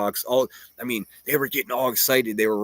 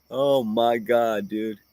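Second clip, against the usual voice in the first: ordinary talk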